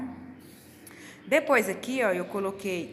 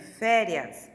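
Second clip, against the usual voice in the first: "férias" with a strong, drawn-out fé; stress on first syllable; 6 dB louder than usual. Shown as a demonstration. Teacher showing pupils where the accent goes, stress on the fé of "férias". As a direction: lean on the fé of "férias". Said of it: "Aonde que é o acento? No fé".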